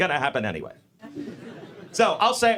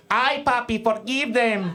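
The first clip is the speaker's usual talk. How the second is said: hammed-up Latin Jesus; 5 semitones higher, slower than usual. Jesus voiced as Spanish lover.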